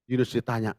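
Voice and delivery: ordinary talk, nothing marked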